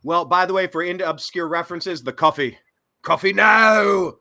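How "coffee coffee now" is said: loud, slow, higher quote; hammed-up cult movie quote; movie line callout. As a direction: belt the line like the film. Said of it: "a reference to the cult classic".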